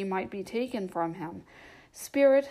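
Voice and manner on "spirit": calling out, high and loud; urgent cry to the spirit; urgent plea. A narrator's reading. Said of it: "with an interest he had never felt before".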